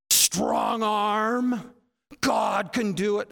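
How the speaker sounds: loud, high, forceful proclamation; mock-impressed boom. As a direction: boom it out like a show of might. Acting out awe at God's might.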